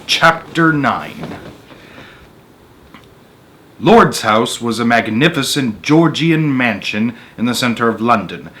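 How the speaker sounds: measured read-aloud narrator voice; story narration. A narrator's reading. Reading a Roald Dahl story to an audience, storyteller voice. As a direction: tell the tale steadily to listeners.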